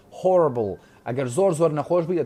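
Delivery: ordinary delivery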